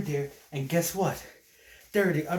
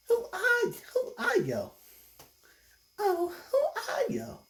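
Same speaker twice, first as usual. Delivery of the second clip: very high falsetto voice; smitten female kangaroo. Acted. Kangaroo with a crush asking who he is, falsetto. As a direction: squeak it in falsetto as a lovestruck kangaroo. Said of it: "there's another kangaroo".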